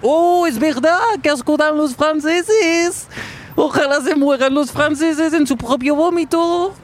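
high sneering caricature of a Spaniard; mocking high-pitched Spaniard.

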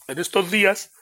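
talking through a yawn; words stretched by a yawn. Real, not acted. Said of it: "perdonad el bostezo".

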